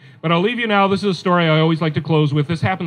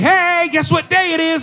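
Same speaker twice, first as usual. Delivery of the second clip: excited greeting; 10 semitones higher than usual. Retold or imitated. Family greeting birthday boy.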